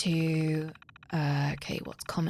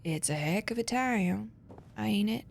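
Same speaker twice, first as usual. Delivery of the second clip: hammed-up Southern American accent; Southern accent from a British speaker, badly done. Shown as a demonstration. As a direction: put on a poor Southern American twang. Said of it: "in my really bad southern accent".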